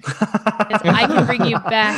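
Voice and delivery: words bubbling with laughter; laughing. Real, not acted. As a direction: laugh through the whole line. Laughing while explaining dex saves.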